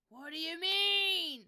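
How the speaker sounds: slow, very loud drawn-out shout; exasperated yell. Real, not acted. Streamer yelling at broken software, exasperated. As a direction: wail it in disbelief. Still fighting the broken display.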